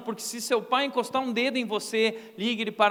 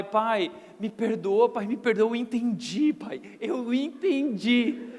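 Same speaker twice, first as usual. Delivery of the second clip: mock pleading child; slower than usual. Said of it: "virava e dizia assim pro meu pai".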